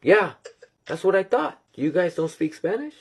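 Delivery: high, insistent questioning; acted disbelief, lifted high; incredulous reply. Retells his surprised reply to friend.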